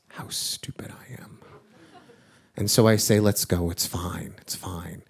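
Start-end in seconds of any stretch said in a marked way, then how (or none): 0.1-1.3 soft, breath-only confession; hushed regret. Real, not acted. Father's quiet, ashamed realization. 3.2-5.0 low, soft reassurance, fading away; gentle giving in. Retold or imitated. Father gives in, soothing his daughter.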